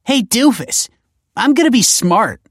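high, loud sibling gloating; excited taunt. A narrator's reading. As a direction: crow at your brother. Teen taunting her brother.

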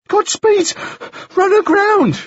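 put-on very high, winded boy; breath in gasps. A narrator's reading. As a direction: break the bad news between breaths. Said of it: "his breath was coming in gasps".

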